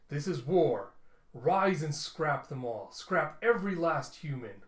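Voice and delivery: robot warlord shouting his army to rise; rallying war cry. Acted. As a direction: rouse the troops to war. Rallying cry to machine kin.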